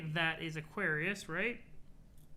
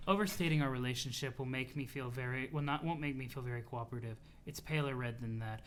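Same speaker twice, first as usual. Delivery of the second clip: lower, softer, measured character voice; composed, formal troll; 5 semitones lower, 5 dB quieter than usual. Acted. Troll declining to help CA, cool and composed. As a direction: answer coolly in a low, quiet voice.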